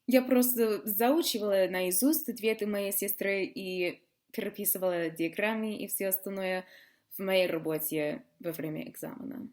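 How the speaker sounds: ordinary talk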